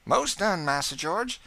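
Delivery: put-on high, loud old cook's voice; Aunt Chloe voice. A narrator's reading. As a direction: talk proudly of Massa George.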